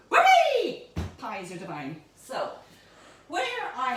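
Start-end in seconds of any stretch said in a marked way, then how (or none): 0.1-0.8 excited. Acted. Big celebratory exclamation.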